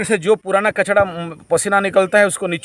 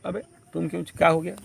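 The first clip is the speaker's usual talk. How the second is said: much softer, toned-down voice; acted softer reply; 2 semitones lower, 7 dB quieter, faster than usual. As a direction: drop the volume right after the yell. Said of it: "तुम प्यार से भी बोल सकते थे".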